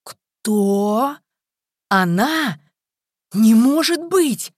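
loud, high-pitched disbelief; shocked gasp. A narrator's reading. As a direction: cry it out in stunned disbelief, stretching the last words. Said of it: "и ахнул".

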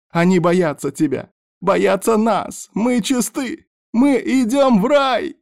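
very high, rapturous shouting; joyful fanatical yell. A narrator's reading. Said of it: "радостно завопил сектант".

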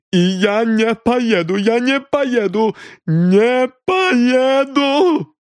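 high, loud, stretched out; hysterical refusal. A narrator's reading. Refuses arrest, repeating. (миссис Крил истерично отказывается ехать)

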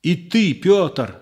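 high indignant cry; angry exclamation. Acted. Disciple rebuking Peter, angry exclamation. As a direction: rebuke him in anger. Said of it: "с гневом воскликнул Иоанн".

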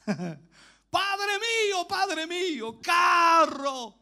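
high, loud, drawn-out cry. Acted. Elisha cries to Elijah carried off, loud high wail. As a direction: call out after the one taken away. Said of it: "y gritaba".

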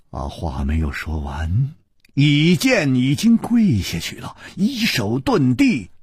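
ordinary delivery

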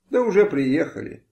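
ordinary delivery

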